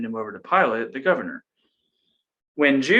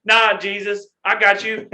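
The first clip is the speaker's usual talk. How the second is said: eager buddy Peter; 9 semitones higher, 7 dB louder than usual. Retold or imitated. Peter voiced loyal to Jesus.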